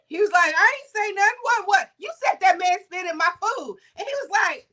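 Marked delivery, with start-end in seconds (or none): 0.5-3.8 higher-pitched back-and-forth dispute; lively imitation of an argument; retold quarrel. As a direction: act out denial, then accusation. Acted quarrel over spitting.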